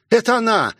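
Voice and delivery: put-on high startled girl's exclamation; alarmed young woman's voice; panicked cry. Rosie panics at attacking car.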